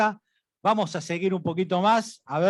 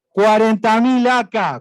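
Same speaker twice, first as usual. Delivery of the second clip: loud bid call; 5 semitones higher, 8 dB louder, slower than usual. Acted. Auctioneer calls a top bid.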